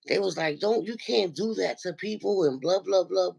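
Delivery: usual speaking voice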